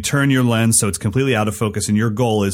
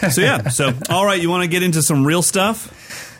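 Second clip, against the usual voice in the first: raised, pumped-up voice; shouting; 7 semitones higher than usual. Real, not acted. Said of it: "Why am I shouting?".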